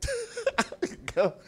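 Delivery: very high delighted laugh; high giggle. Real, not acted. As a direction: giggle with joy. Laugh after thanking friend.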